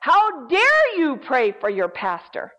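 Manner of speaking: imitated demon's loud accusation; menacing voice. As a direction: stretch out the challenge, loud and threatening. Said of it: "a deep, menacing voice".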